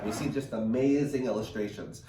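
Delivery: plain reading voice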